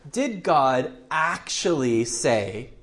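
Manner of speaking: "actually" drawn out slowly and stressed. Shown as a demonstration. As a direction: lean hard and slow on the word "actually". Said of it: "the most emphasized word".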